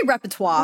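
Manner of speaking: repertoire said REP-uh-twah; French-style repertoire. Shown as a demonstration. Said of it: "That's the French way to say it".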